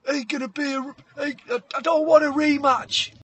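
high-pitched boxer giving in; hammed-up beaten fighter's line; Maui conceding. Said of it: "He goes, he goes".

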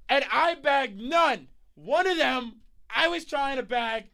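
loud, high, stretched-out hype shouting; fired-up rant. Real, not acted. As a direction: yell it out in jealous amazement. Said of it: "Here's where I'm about to get a little lit".

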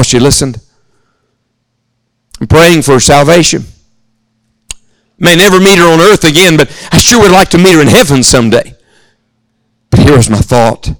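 ordinary delivery

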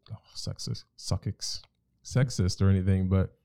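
normal talking voice

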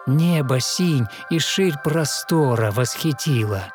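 ordinary delivery

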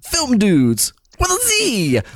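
very high, drawn-out excited cry; hammed-up, stretched-out glee on each word; Brian. Host imitating the friend who suggested the name, gleefully excited. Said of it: "the excitement that he put into saying".

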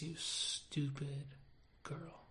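soft, slow, falling to a whisper; halting, nearly whispered confession; hushed regret. Acted. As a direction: let the last word drop away in shame. Regretfully recalls last words to sister.

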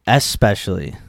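"especially" said as "ess-pecially"; confirmed pronunciation. Shown as a demonstration. Host echoing the answer, "especially" without a K. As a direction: repeat "especially" with S and no K. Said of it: "Mia says".

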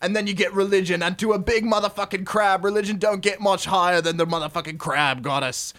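hammed-up, sweary Aussie rules reading; put-on broad Australian accent, punching the swear words; bad Aussie accent. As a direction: read it out like a crude Australian trucker. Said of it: "do it in Aussie accent".

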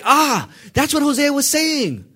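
high, drawn-out "Ah" of discovery; sudden realization. Acted. Preacher voices Matthew grasping Hosea's prophecy, sudden realization. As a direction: exclaim it slowly, high-pitched, as it all clicks.

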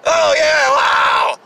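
high, loud fake whoop; hammed-up applause shout; mock crowd cheer.

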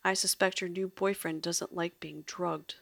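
ordinary delivery, nothing marked

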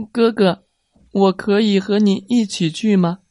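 high, eager little brother; a small boy pleading to join in. A narrator's reading. Little brother asking big brother, eager and high.

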